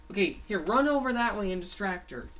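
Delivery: urgent instruction. Acted. Fango sends Fangy toward the zombie, urgent instruction.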